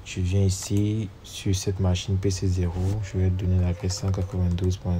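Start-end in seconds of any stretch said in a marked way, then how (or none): none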